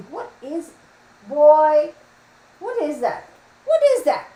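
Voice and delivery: high-pitched disgusted pet scolding; mock scolding. Real, not acted. Mock scolding her dog.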